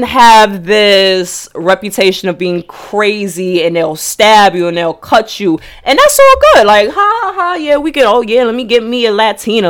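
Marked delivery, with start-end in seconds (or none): 5.8-9.7 high mocking voice with fake laugh; sneering imitation, pitched way up; mocking men. Mocking men praising Latinas.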